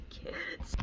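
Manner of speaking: unvoiced, exasperated whisper; whispered sigh. Real, not acted. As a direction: breathe out the word in weary disbelief.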